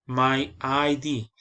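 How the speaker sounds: "I D" said as two separate letters, slow. Shown as a demonstration. Teacher showing students "I D" as two letters. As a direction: spell out "I D" slowly, each letter apart. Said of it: "se pronuncia letra por letra".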